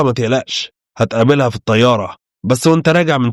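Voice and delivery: plain reading voice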